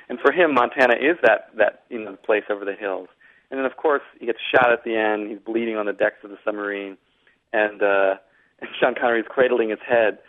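ordinary talk

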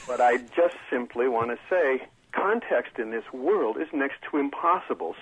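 normal talking voice

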